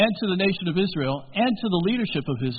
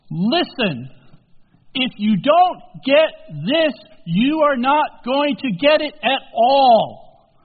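louder, slow stern warning; 5 semitones higher, 6 dB louder, slower than usual. Acted. Jesus warning his followers, stern and slow. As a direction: lay down the law.

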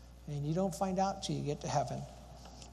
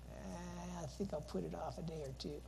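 much softer, offhand shrug of a reply; noncommittal put-off; 2 semitones higher, 12 dB quieter than usual. Acted. Listener putting off a decision about the message, noncommittal. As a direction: brush it off quietly, half-interested.

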